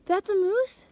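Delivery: high, loud disbelief; surprised. Real, not acted. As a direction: ask it in astonishment. Disbelief at the moose.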